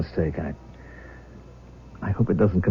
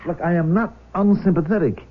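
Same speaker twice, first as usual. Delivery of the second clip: indignant; 9 semitones higher than usual. Acted. Father confronts the birth mother.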